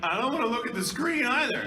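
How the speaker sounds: loud, high retold protest; mock alarmed protest. Engineer refusing to watch the booth, loud high protest. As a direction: imitate someone protesting, voice pushed up.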